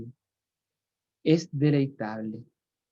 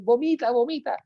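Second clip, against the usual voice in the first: urging encouragement; 8 semitones higher than usual. Retold or imitated. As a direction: egg yourself on to let it out. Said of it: "Entonces dice".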